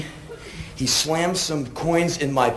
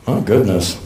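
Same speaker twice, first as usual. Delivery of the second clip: disdainful gentleman; 9 semitones lower than usual. Retold or imitated. Disdainful man in coat.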